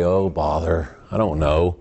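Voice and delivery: low, resigned little bear voice; lightly imitated low, bumbling bear; Winnie the Pooh. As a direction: sigh it out low, mildly stumped. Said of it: "then he finally would say".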